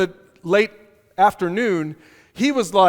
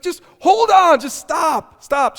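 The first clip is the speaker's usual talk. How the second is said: exasperated stop; 8 semitones higher, 4 dB louder, slower than usual. Real, not acted. Pastor cuts off rule-fussing.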